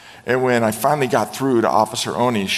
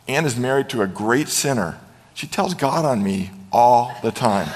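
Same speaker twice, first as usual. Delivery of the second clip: normal talking voice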